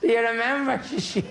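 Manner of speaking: wife reminds Seamus of their wedding night; old wife's punchline. Retold or imitated. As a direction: finish with a cheeky old wife's bawl.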